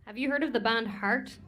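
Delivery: normal talking voice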